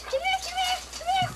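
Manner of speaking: high sing-song call; calling a pet. Real, not acted. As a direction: coax the dog over with a high, bright call. Calling the dog over.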